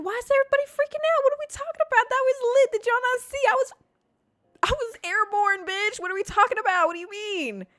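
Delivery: very high, squeaky incredulous rant; hyped disbelief. Retold or imitated. Hyped kid to freaking-out friends, incredulous squeal. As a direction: squeal it, baffled that nobody gets it. Acting own thrilled, indignant disbelief.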